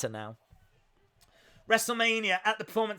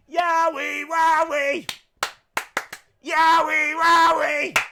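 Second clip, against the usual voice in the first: mock gleeful cheer; 9 semitones higher, 6 dB louder, slower than usual. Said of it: "he's like".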